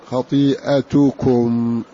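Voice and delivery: خطيئتكم slow, short "a" between hamza and ت, ending "tukum"; without alif. Shown as a demonstration. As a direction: draw out خطيئتكم as "khatii'atukum". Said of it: "من غير ألف بين".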